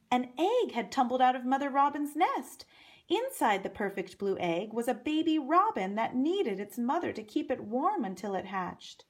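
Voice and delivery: plain reading voice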